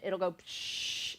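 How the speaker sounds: imitated steam hiss; pressure hiss. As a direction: make the psh of escaping steam. Imitating the pot releasing steam.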